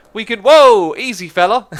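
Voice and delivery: real surprise mid-sentence; startled whoa. Real, not acted. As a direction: yelp as you're launched. Launched unexpectedly by ladder.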